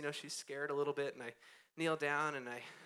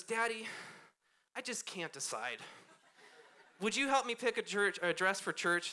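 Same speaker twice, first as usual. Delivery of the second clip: put-on higher pleading child; little girl voice; 5 semitones higher than usual. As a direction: ask like an undecided four-year-old. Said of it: "She goes".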